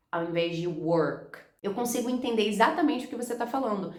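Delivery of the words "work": "work" with a throaty Rio R, slowly; carioca R in "work". Shown as a demonstration. Teacher demonstrating the Rio R in "work", slow and throaty. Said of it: "esse R do r".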